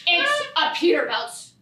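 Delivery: normal talking voice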